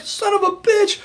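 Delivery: very high exasperated curse; mock frustration. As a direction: blurt it out in disbelief. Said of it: "And I was like".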